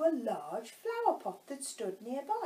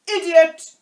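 loud, high, impatient outburst; 9 semitones higher, 21 dB louder than usual. A narrator's reading. Queen insults the silent Knave, impatient shout. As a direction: snap it out loudly as an insult. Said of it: "tossing her head impatiently".

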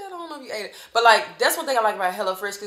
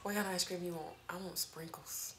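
whispering; 3 semitones lower, 16 dB quieter than usual. Real, not acted. Whispered ice cream wishes.

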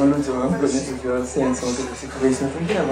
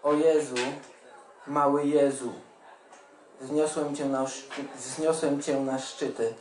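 measured poem reading, softer than chatting; poetry recitation; 6 dB quieter than usual. Acted. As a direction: recite line by line with pauses between phrases. Reads own poem aloud.

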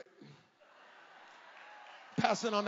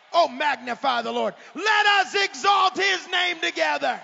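loud psalm reading building up; rising preached reading; preached scripture; 9 dB louder than usual. Real, not acted. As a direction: proclaim the psalm.

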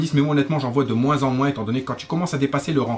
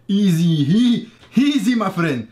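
cocky taunting voice, a bit louder; smug cheater taunt; 5 semitones higher, 4 dB louder, much slower than usual. Retold or imitated. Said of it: "le genre de mec à te dire".

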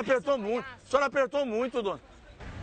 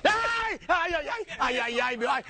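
pained cries; 4 semitones higher, slower than usual. Acted. Prank victim cries out in pain.

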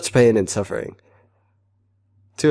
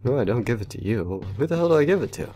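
ordinary talk, nothing marked

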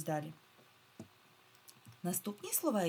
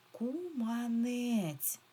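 КУМАНЕЦЬ stretched very slowly, higher; drawn-out word; 6 semitones higher, much slower than usual. Shown as a demonstration. Teacher breaks down the word for pupils, drawn-out. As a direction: sound out КУМАНЕЦЬ, every vowel long. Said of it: "Давайте розберемо це слово".

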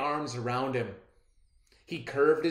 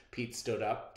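ordinary delivery

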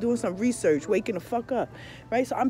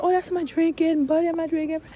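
mock girly voice; 10 semitones higher, 6 dB louder than usual. Mocking the other girl's reply.

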